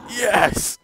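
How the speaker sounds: high, triumphant yelp; excited cheer. Real, not acted. Player cheering as the demon falls, in a high excited yelp. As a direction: celebrate the win with a squeal.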